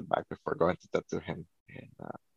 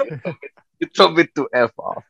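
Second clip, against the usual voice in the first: higher, laughing retold admission; laughing retold confession; 7 semitones higher, 4 dB louder than usual. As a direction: crack up as you quote your own confession. Retold confession, teller cracks up.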